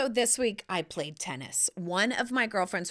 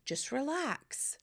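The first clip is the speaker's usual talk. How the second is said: calm self-talk; 4 semitones higher, 6 dB quieter than usual. Pretend. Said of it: "and go".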